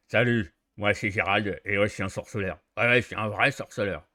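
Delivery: heavy witcher with jaw thrust out; heavy jutting jaw. Shown as a demonstration.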